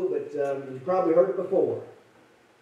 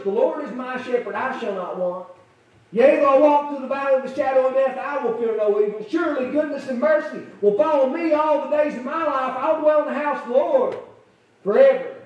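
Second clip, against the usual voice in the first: scripture recital; 4 semitones higher than usual. Acted. Recites Psalm 23.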